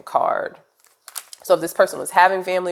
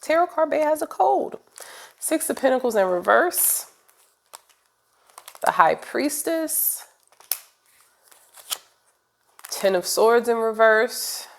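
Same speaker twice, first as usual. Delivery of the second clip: 1 sing-song high; 7 semitones higher than usual. Acted. Sick reader jokes, keeps high voice.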